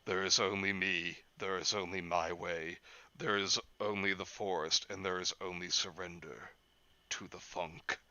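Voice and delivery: low, soft, menacing Beast; a quiet looming forest monster. Retold or imitated. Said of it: "the beast from over the garden wall saying".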